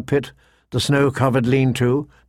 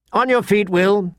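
higher, sharp command; 6 semitones higher than usual. A narrator's reading. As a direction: order him up like a lord. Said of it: "Sir Waymar commanded".

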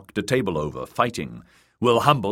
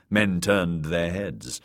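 usual speaking voice

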